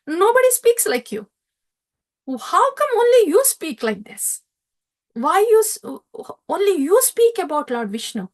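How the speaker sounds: put-on teacher rattled by the boy's answers; alarmed teacher. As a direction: demand to know who taught him. Teachers challenge Prahlad, alarmed.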